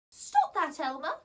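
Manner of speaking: loud, high fairy telling off; sharp little fairy reprimand; scolding fairy. Acted. Holly scolds a naughty elf.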